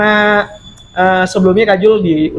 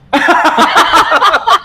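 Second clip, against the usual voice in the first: loud, high burst of laughter; sudden big laugh; 11 semitones higher, 5 dB louder than usual. Real, not acted. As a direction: crack up at a joke answer. Laughs at the joke answer.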